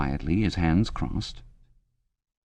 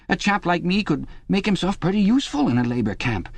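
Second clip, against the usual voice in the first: much higher, eager, anxious chap's voice; a frightened man begging for reassurance, pitched up high; Parsons, nervous and pleading; 9 semitones higher than usual. Acted. Parsons begging Winston in the cell, high anxious pleading.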